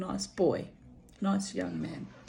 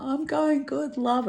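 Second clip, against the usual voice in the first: mock reply; 9 semitones higher, slower than usual. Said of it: "And I'm like".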